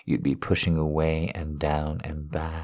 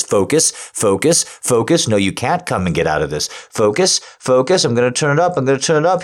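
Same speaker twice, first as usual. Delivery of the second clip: higher, louder, driving commands; intense urging; 10 semitones higher, 10 dB louder, faster than usual. Acted. Urging his wife to focus as he turns it up, loud and high. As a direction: push her on, urgent and loud.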